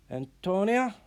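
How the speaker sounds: loud call across the room. Acted. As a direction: call her name sharply to get her attention. Teacher calling the apprentice.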